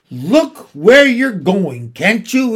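a loud, slow, snapping shout. A narrator's reading. Old gentleman scolding someone who bumped Jane, shouting. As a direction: bark the warning at whoever bumped him. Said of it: "he shouted".

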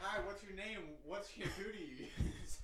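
high, mock-sweet pickup line; imitating a flirt. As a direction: squeak it like an awkward flirt. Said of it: "You were like".

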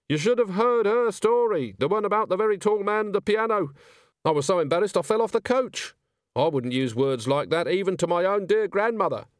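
a rattled coach driver drawling out his memory. Acted. Driver tells the agent, high and dazed. Driver complains about the story.

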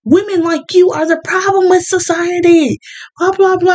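high mocking voice of a scolding commenter; mocking online attacker. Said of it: "It's like".

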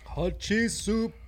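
high, slow goofy voice; drawn out silly accent. Pretend. Silly repeated chant.

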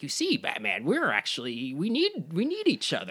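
hammed-up creepy Joker imitation; mock Joker voice. Mocking the Joker dialogue to a co-host, creepy Joker voice. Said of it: "He's like".

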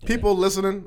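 ordinary talk

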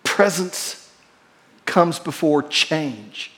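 slow, the end dropping low and soft; weighty preacher's close; slow emphatic point. Real, not acted. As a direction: land the main point slowly. Key point repeated.

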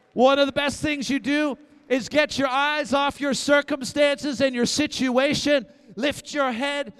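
loud, building preacher's call; full-force preaching. Acted. Pastor urging the church to lift their eyes, in a loud preaching call. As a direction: drive each phrase home to the crowd.

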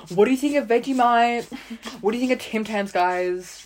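lightly hammed-up Australian; amped-up Australian accent. Fan questioning YouTubers, trying to sound extra Australian. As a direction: play up the Australian accent a little. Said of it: "trying to amp up, like, how Australian I was".

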